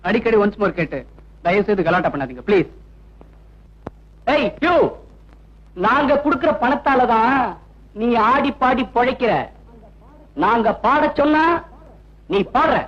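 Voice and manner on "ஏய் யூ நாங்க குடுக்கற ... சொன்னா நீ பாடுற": bullying demand. Acted. Heckler ordering the singer to sing.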